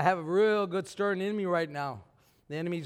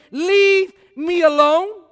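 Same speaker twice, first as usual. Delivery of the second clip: loud, high, slow Leave me alone with a rising tune; emphatic repeat; 9 semitones higher, 14 dB louder, much slower than usual. Acted. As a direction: hammer each word in disbelief. Preacher repeats it mockingly.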